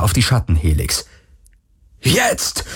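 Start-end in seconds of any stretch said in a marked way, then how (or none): 2.0-2.6 sudden high, strained cry; calling out. A narrator's reading. Galen signals for the attack, calling out high. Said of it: "rief Galen".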